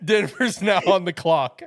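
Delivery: loud, high, through real laughter; loud and high between bursts of laughter; laughing announcement. Real, not acted. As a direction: announce it while cracking up. Cracking up after the trade.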